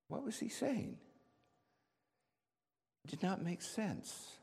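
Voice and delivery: high, soft, puzzled voice; hushed bewilderment. Acted. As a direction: wonder aloud in baffled disbelief. Peter's baffled inner question.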